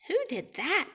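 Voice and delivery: high startled who; startled question. Acted. Pete startled.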